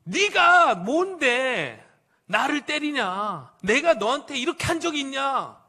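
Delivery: loud, high, drawn-out outrage; worked-up protest. Acted. Donkey protesting to Balaam about being beaten, loud worked-up outrage. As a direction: yell it as an indignant donkey protesting a beating. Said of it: "흥분해가지고".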